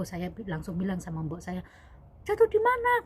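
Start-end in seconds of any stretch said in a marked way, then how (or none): 2.3-3.1 high, loud cry of alarm; retelling an employer's startled question. Retold or imitated. Employer hearing the maid fell, alarmed question. Employer voiced reacting to her fall.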